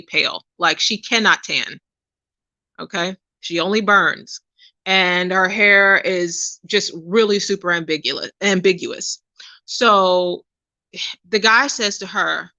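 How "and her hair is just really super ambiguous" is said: normal talking voice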